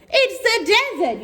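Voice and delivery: high, laughing scornful sister; mock her silly sister. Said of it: "her sister Madge said".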